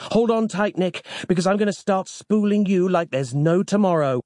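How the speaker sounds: high-pitched, urgent character voice; growled warning. A narrator's reading. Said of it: "growled the librarian huskily".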